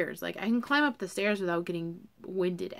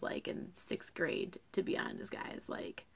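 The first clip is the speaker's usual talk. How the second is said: embarrassed whisper; 9 dB quieter than usual. Real, not acted. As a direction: drop to a soft, sheepish whisper. Sheepish confession to viewers.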